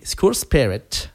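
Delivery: song title said slowly with an English accent. Shown as a demonstration. Radio host announcing the song title in a showy English accent. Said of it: "admirez mon accent".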